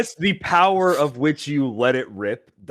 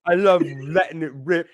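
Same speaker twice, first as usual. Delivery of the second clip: mock British accent; 5 semitones higher than usual. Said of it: "a bad accent from a different country".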